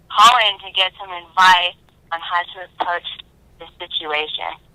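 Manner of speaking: usual speaking voice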